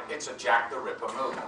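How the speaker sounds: ordinary talk, nothing marked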